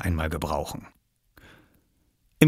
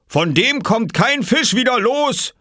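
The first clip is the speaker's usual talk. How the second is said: higher, slow, louder call; calling after him; 5 dB louder, slower than usual. Acted. Farmer calling after Oskar as he runs away. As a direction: call after a running boy. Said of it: "rief Bauer Johansen ihm hinterher".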